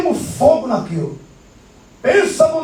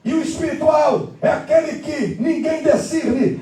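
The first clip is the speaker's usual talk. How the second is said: high, emphatic pulpit proclamation; high-pitched sermon delivery; full-force preaching; 6 semitones higher than usual. Acted. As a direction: preach it out to the whole congregation.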